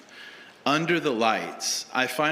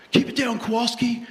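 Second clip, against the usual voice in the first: coach hushing; 9 semitones higher, faster than usual. Retold or imitated. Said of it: "He's like".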